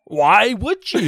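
high, loud, drawn-out disbelief; incredulous. Real, not acted. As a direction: challenge him slowly, half laughing. Friend disputes why he agreed.

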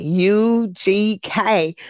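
U G K very slow and stretched, Southern drawl; imitated Southern drawl. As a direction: mimic a drawling "U G K", each letter held long. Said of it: "kind of Southern drawl to it".

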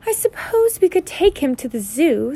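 high aunt voice, reluctantly considering; slowly. A narrator's reading. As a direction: think it over in a high put-on voice. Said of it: "said Aunt Petunia slowly".

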